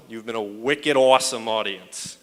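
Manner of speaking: a hint of Boston accent, shouted out. Signing off to the crowd in a light Boston accent. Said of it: "as we say in Boston".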